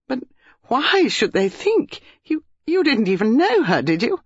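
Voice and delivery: high, halting, anxious question; falter, then press for reassurance; anxious disbelief. A narrator's reading. Dinah asks whether Basil knew the girl, anxious disbelief.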